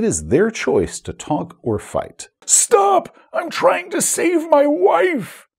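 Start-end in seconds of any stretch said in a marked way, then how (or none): 2.4-5.5 high, strained, drawn-out yell; loud pleading bad-guy wail; villain's desperate shout. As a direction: cry it out slowly at the top of your pitch. Same villain cry repeated, acted.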